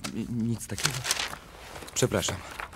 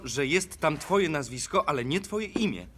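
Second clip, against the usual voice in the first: heated anger; 9 semitones higher, 4 dB louder than usual. Acted. Family quarrel erupts at table.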